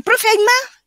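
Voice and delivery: high, loud eager plea; eager student. Retold or imitated.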